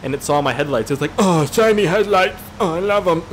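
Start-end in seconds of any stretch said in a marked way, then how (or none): 1.2-3.2 put-on high, drawn-out gushing teenager voice; starstruck teenager deer. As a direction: gush loudly like a dazzled teen. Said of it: "a teenager".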